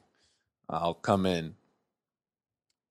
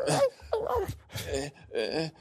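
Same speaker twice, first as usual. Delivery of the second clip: ordinary talk, nothing marked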